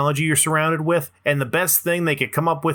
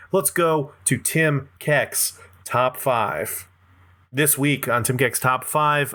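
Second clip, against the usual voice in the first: segment announcer; much slower than usual. Acted. Introducing his segment.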